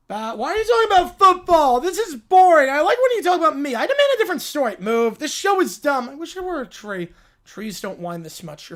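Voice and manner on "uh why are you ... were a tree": put-on loud whiny child, bored and demanding; bratty kid. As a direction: interrupt like a spoiled kid. Kid character interrupts the host.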